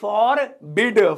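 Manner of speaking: फॉरबिड said slowly and clearly, stress on बिड; slow clear model word. Shown as a demonstration. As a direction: draw out फॉरबिड for learners to copy. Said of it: "फॉरबिड बोलते हैं इसे".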